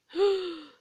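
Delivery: loud, high shocked gasp; indignant gasp. Acted. Queen offended by Alice's refusal, loud gasp. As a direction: gasp in outrage.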